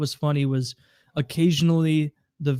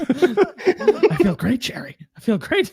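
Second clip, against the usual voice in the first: very slow, higher, louder drawn-out Kramer voice; Kramer impression; 10 semitones higher, 4 dB louder, slower than usual. Friend quoting Kramer's sleepy boast. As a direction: stretch it out, laughing.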